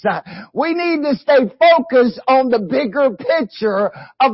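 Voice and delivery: rising, emphatic sermon delivery with shouted peaks; preaching. Acted. Preacher urging the congregation, building to shouts. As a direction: drive the message home to the church.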